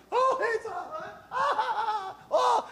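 high, breathless, laughing joy; overjoyed gasping. Retold or imitated. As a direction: stammer with overwhelmed delight. Disciples overwhelmed with joy.

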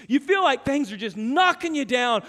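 normal talking voice

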